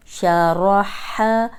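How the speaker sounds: usual speaking voice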